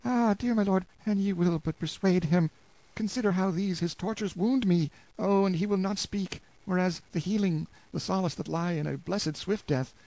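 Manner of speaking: put-on high, trembling plea of a desperate young wife; pleading wife. Anguished wife begging the lord to persuade Hugo, high pleading voice. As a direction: beg him to save your husband.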